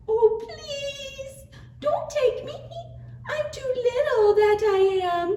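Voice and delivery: tiny, very high squeaky plea; small goat, pleading; pleading little goat. A narrator's reading.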